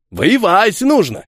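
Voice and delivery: high, slow and lofty; self-important. Acted. Soldier boasting to the old guard, slow and lofty. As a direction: lecture him grandly. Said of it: "важно ответил Ефим".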